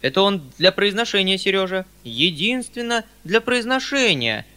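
высокое, настойчивое оправдание; упрашивай поверить, громче и выше; просительный голос. A narrator's reading. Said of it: "каким-то просительным голосом". (просительная интонация)

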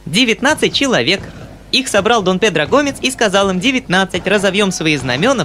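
put-on small, clear, high little boy's voice; even singsong schoolboy recital; child's rote recitation. A narrator's reading. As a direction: rattle off the verses without commas or stops. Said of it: "ровным и ясным голосом, без запятых и без точек".

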